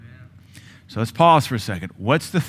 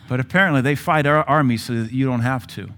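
usual speaking voice

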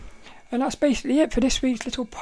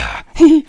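whispered laugh; 4 semitones higher, 13 dB louder than usual. Real, not acted. Laughs after demon voice.

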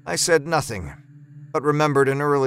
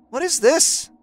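much higher voice, sharp; wife recoiling at the smell; Laura's disgusted question; 12 semitones higher than usual. A narrator's reading. Wife walks in on the fumes, higher and sharp. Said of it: "she said, covering her nose".

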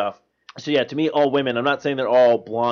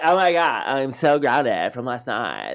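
drawn-out mock sorority girl; cheerful airhead reporting bad news; bubbly put-on girly voice; happy bimbo girl; slower than usual. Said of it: "they still sound happy".

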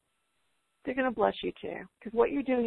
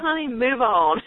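slow, laughing and louder; laughing advice; 6 dB louder, slower than usual. Real, not acted. Speaker teasing the chat mom, slow laughing. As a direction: chuckle it out as gentle teasing. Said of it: "playful advice with laugh".

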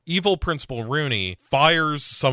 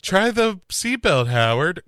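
woozy hero; 8 semitones higher, 3 dB louder than usual. Retold or imitated. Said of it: "But he's like".

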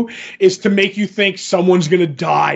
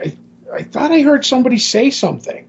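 quick, higher, startled whisper-to-self; startled character voice; 6 semitones higher, faster than usual. Retold or imitated. Retelling the comic, a startled character, quick and higher. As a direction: jump at a voice you half heard.

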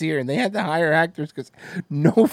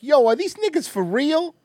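high, incredulous voice; mock incredulous question; 9 semitones higher than usual. Host acting out the family's reaction to the actors, incredulous. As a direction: pitch it way up in mock disbelief.

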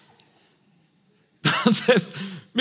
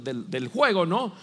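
ordinary talk

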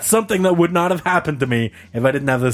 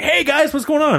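higher-pitched cheery hello; friendly greeting; 13 semitones higher than usual. Retold or imitated. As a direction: imitate an upbeat stranger saying hi. Voices a friendly stranger's greeting.

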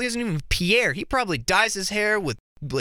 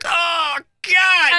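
higher, louder exasperated shout; retold shout; 10 semitones higher, 6 dB louder than usual. Seeing the mime in the alley, shouting in disbelief. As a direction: yell it out in disbelief. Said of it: "and I was like".

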